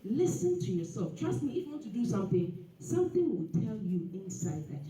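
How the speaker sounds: ordinary talk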